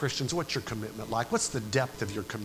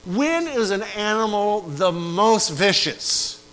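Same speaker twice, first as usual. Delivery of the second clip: higher, louder and slow; slow fiery question; 7 semitones higher, 6 dB louder, slower than usual. Acted. Preacher asking about cornered animals, slow and loud. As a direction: hurl the question at the room.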